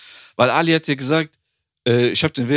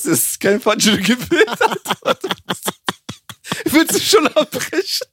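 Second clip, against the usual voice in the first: slow, higher, laughing disbelief; higher, slowed, laughing "Willst du schon abbrechen?"; laughing retelling; 10 semitones higher, slower than usual. Retold or imitated. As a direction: relive the moment through laughter. Retold inner thought, laughing.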